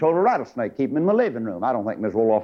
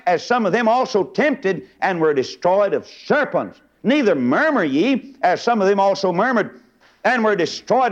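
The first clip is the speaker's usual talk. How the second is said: raised, measured, sing-song Bible reading; scripture reading from the pulpit; 5 semitones higher than usual. Acted. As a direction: read scripture out high and deliberately to a congregation. Reads scripture aloud from the pulpit.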